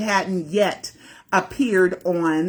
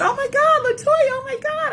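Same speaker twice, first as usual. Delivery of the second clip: starstruck squeal. Retold or imitated. Fan spotting [name] across the restaurant, high starstruck squeal. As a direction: squeal her name in disbelief.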